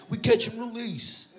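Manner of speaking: high, lightly mocking repeat; mimicking the buddy. Comic repeating buddy's catchphrase, mocking. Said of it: "That's what he kept saying".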